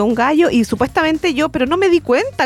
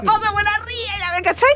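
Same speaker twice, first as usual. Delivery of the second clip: ordinary talk, nothing marked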